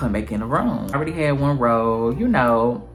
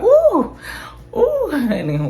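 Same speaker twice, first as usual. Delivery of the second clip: very high, loud squeal of delight; high excited ooh honey; 4 dB louder, much slower than usual. Retold or imitated. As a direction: squeal at the surprise. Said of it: "I was just like".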